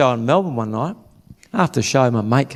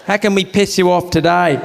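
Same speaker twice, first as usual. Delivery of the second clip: mock phone greeting; 5 semitones higher, slower than usual. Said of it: "Imagine if that was their name".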